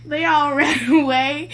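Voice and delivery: slow, high words broken by laughter; can barely get it out for laughing. Real, not acted. Repeating the punchline, laughing.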